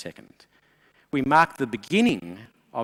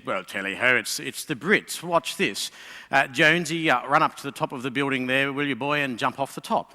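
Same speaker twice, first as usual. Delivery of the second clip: British general. Acted. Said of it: "And the British general said".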